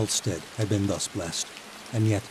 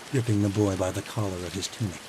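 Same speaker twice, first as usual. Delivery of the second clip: ordinary delivery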